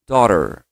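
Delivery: American daughter, dó-ror; American pronunciation. Shown as a demonstration. Teacher shows students the American daughter. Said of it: "influência americana".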